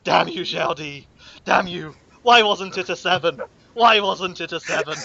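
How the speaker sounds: ruined man at the table, theatrically grieving; mock weeping curse. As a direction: curse the merchant through fake tears. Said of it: "weeping on the table going".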